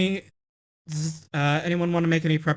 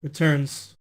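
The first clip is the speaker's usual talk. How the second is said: dramatic narration; 2 semitones lower than usual. Acted. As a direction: narrate the monster's fall. Narrates the monster's defeat.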